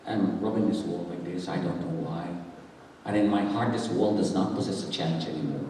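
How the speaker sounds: very low, soft solemn confession; near-whispered testimony; hushed testimony. Real, not acted. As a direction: confide it gravely to the congregation.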